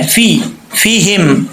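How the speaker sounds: فی said with a clear long i, not fe; correct kasra vowel. Shown as a demonstration. Tutor modelling فیہم with kasra for the pupil. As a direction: stretch فیہم as fee-him, slowly. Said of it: "فا زیر فی ہے، فے نہیں ہے".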